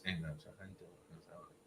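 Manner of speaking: very soft, low imitation, nearly inaudible; quiet talker. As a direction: mimic a low talker, hardly any volume. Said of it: "he talks like this".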